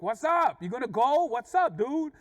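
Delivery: loud, high-pitched irritated challenge; mad challenge. Retold or imitated. Irritated driver gestures at the man ahead, mad challenge. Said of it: "I'm getting mad and stuff".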